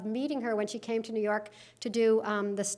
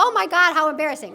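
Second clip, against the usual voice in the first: mock embarrassment; 4 semitones higher, 6 dB louder, faster than usual. Mock reaction to the mall mix-up.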